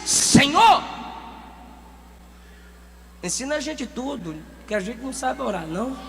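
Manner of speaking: súplica humilde. Acted. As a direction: pray humbly, almost hushed. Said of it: "Ele chega lá e diz". (súplica baixinha e humilde)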